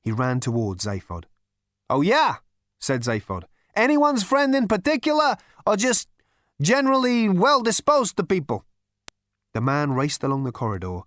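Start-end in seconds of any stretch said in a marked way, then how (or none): 1.9-2.4 high, loud retort; loud challenge. Acted. Zaphod doubting the approaching stranger, loud high retort. 3.8-8.6 high, loud questioning; shouted challenge. Acted. Zaphod questioning the man racing towards him, shouted challenge.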